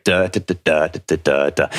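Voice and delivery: "Da, da, da" tapped out as a steady pulse; rhythmic "da da da" marking the dactyls; beating rhythm. Shown as a demonstration. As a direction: beat out the meter like hoofbeats. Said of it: "I think of it as hoofbeats and heartbeats".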